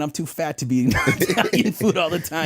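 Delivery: talking through laughter, pitched high; laughing. Real, not acted. Guest joking with host about his weight, laughing. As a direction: laugh your way through the line.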